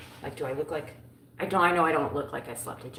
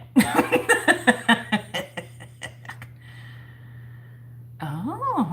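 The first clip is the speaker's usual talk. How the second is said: long, high, loud cackling laugh; helpless laughter; 9 semitones higher, 7 dB louder than usual. Real, not acted. Streamer laughing at mixing up friends, helpless loud laughter. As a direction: burst out laughing and keep going. Caught confusing overseas friends.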